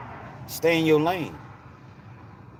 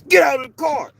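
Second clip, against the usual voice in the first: hollered high and very loud; 13 semitones higher, 7 dB louder than usual. Retold or imitated. Officer yelling at a young lady, hostile scream. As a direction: yell it as a hostile police command. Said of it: "That racist kind of tone in your voice".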